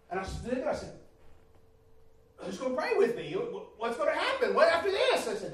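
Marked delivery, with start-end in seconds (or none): 2.4-5.3 high, loud, half-laughing questions; bewildered plea. Retold or imitated. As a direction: ask in eager confusion. Retelling confused plea after altar call.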